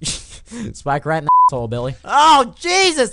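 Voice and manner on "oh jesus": high, loud exclamation; shocked outcry. Real, not acted. Player killed by a spike, shocked high shout. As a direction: cry out at the sudden death.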